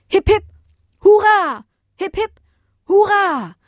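loud, high-pitched hurrahs; jubilant cheer. A narrator's reading. Villagers cheer Bodo in turn, jubilant. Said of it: "riefen die Menschen wieder".

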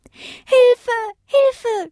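high, loud cries for help; boy in the river screaming for help. Acted. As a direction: yell for help from the water. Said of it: "schrie der Junge".

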